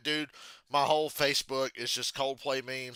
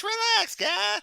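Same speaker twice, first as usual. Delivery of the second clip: relax squeaked far higher and loud; squeaky relax; 6 dB louder, much slower than usual. Shown as a demonstration. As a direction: say "relax, guy" in a shrill squeal. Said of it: "I'm not saying relax".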